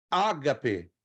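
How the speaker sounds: "ágape" stressed on its first A; stress on first syllable. Shown as a demonstration. Teacher showing students a Greek transliteration, stress on the first syllable of "ágape". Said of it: "na primeira sílaba".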